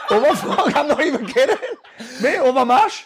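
laughing disbelief. Real, not acted. Host laughs that his guest hates mash, laughing.

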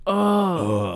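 loud moaning groan. Retold or imitated. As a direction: groan like a tummy ache. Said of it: "she would".

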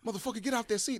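high yelled threat from behind; retold yell. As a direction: bark it like an angry teammate. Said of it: "I'm hearing somebody go".

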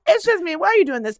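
loud, high hammed-up pleading; mock desperate plea. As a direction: beg like a betrayed lover. Acted quarrel continues.

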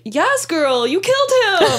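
high, loud cheer; mock cheer. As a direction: cheer her on like a hype friend. Cheering Blanche's murder.

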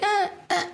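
high, strained demonstration grunts; short straining "uh" sounds; pushing grunt. Shown as a demonstration. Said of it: "I'm pushing more".

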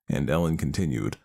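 ordinary delivery, nothing marked

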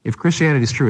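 usual speaking voice

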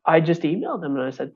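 ordinary delivery

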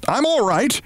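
high yell to reassure; reassuring yell. Acted. Cousin yelling up to the mother to reassure her. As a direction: call it out so she stops worrying. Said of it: "Briggs yelled to reassure her".

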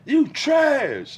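high-pitched taunt, drawn from on-court needling; mock trash talk. Teammate imitating a player ripping an opponent on court, mock taunt. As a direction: throw it out as a high, teasing insult.

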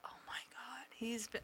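whispered exasperation. Real, not acted. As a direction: drop to a hush to vent about him. Complaining about son.

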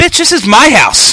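mock domineering. Roommate to visiting girlfriend, mock domineering. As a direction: lay down the law as a hammed-up homeowner. Imagined roommate laying down the law.

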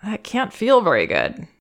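really see his pain and say so; heartfelt empathizing parent; empathic acknowledgement. Shown as a demonstration. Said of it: "we should ideally be able to acknowledge".

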